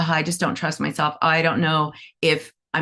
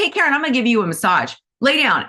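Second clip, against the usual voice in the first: pushy; 6 semitones higher, much faster than usual. Retold or imitated. Pushy masseuse acted out.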